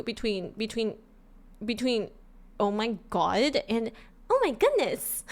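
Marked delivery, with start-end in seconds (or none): 2.6-3.6 put-on slow, drawn-out valley-girl delivery; California girl. Shown as a demonstration. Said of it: "What's the difference between the two?". 4.3-5.2 high, sweet and innocent; innocent girl-next-door. Shown as a demonstration. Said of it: "One is innocent and one is bratty".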